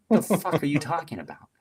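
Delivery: high-pitched incredulous outburst; acted-out indignant bafflement; retold disbelief. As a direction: push the pitch up in baffled protest. Retold shock at changed orders.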